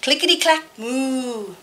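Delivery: put-on lively read-aloud for kids; story narration. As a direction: tell it brightly to a room of children. Reads picture book aloud.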